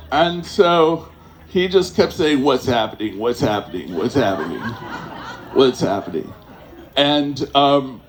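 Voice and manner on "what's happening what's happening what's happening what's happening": low, dazed mumbling repeat; dazed stoned friend. Retold or imitated. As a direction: sound lost and spaced out, pitched low.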